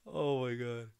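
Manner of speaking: ordinary talk, nothing marked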